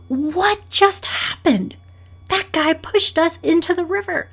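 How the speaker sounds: high, loud, furious protest; indignant outrage. Acted. Person shoved into the river, outraged and yelling. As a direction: rant like someone just shoved into a river. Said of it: "yelling and being so upset".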